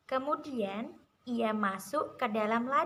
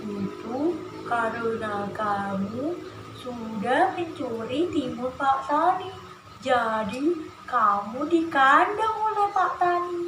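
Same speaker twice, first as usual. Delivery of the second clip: dog character voice; 6 dB louder than usual. Shown as a demonstration. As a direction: answer as a guard dog, stretching the words. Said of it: "Kalau suaranya anjing gimana?".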